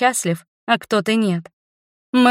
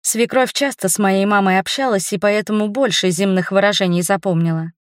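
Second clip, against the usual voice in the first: ordinary delivery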